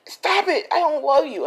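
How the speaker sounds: loud falsetto pleading scream; high, piercing mock cries; retold screaming. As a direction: imitate a desperate woman screaming, pitched very high. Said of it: "She's screaming".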